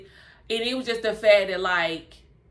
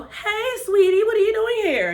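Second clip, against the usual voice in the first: high, fake-sweet voice; sugary mock greeting, caught off guard; imitating Vanessa; 13 semitones higher, 4 dB louder than usual. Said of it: "You know how Vanessa do".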